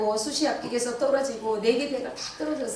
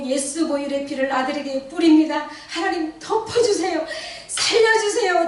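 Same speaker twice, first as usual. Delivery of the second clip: frantic prayer; 6 semitones higher, 5 dB louder than usual. Retold or imitated. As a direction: plead like a woman beside herself. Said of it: "미친년처럼 눈을 뜨고 기도했어요".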